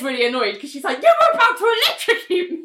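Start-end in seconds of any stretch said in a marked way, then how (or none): 1.0-2.5 high, squeaky outrage; indignant old lady. Retold or imitated. Bria scolds the doctor who nearly shocked her, indignant. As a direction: squeal it like an affronted granny.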